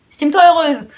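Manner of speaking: laughing. Real, not acted. Joking about free miniature gifts.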